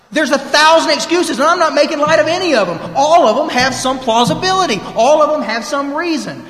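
pulpit-style loud exhortation, slowing right down on horse crap; loud preaching. Real, not acted. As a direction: drive it home to the room, loud and high. Preaching against excuses.

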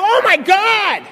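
hammed-up emergency panic; panicked outcry. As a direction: wail it like a hypochondriac. Acts out panic at seeing bruise.

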